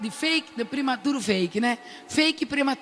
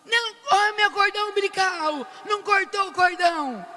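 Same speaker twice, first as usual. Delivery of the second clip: mother yelling; 7 semitones higher than usual. Retold or imitated. Mother Paula to the doctors, high alarmed yell. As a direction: cry out high about the cord. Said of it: "A Paula grita".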